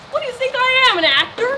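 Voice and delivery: indignant. Acted. Indignant refusal to play dead.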